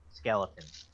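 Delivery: normal talking voice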